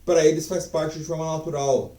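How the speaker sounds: ordinary talk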